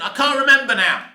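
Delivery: ordinary delivery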